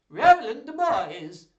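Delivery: very loud, slow, high exclamation; booming duke. A narrator's reading. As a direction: bellow the name in grand recognition. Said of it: "laughed Senior".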